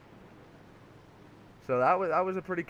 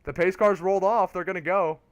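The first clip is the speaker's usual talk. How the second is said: raised, excited play-by-play; race commentary; 6 semitones higher, 7 dB louder than usual. Real, not acted. Commentator calling the restart with two laps left, excited. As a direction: call the green flag with urgency.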